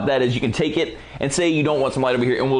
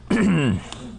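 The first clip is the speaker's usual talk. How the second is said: throat clearing; 4 semitones higher than usual. Real, not acted. Clears throat before demo.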